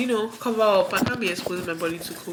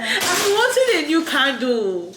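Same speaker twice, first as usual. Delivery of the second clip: excited; 6 semitones higher, 5 dB louder than usual. Real, not acted. Thanking friend for gift.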